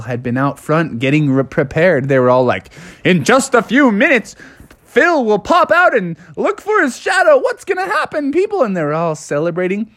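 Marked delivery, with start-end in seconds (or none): 3.0-8.6 high, shouted announcement to the crowd; rally the crowd like a herald; excited town crier. Acted. Townspeople await Phil.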